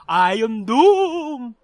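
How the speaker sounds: ordinary delivery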